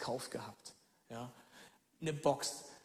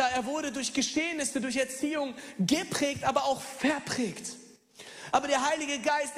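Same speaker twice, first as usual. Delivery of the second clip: raised, fervent preacher's voice; preaching at full force; 7 semitones higher, 4 dB louder than usual. Acted. Pastor urging his congregation, fervent preaching. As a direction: preach it out to the whole hall.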